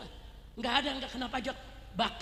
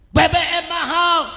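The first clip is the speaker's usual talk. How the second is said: loud, high, drawn-out mock complaint; imitated protest shout; 6 semitones higher, 13 dB louder, slower than usual. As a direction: yell it as the protesting men. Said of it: "Bapak-bapak juga teriak".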